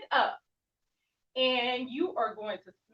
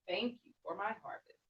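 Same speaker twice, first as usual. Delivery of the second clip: much softer, lower thank-you; grateful and soft; 4 semitones lower, 9 dB quieter than usual. Shown as a demonstration. Teacher ending the sun salutation, soft and grateful. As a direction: hand on heart, quiet thanks. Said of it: "bringing my hand to my heart".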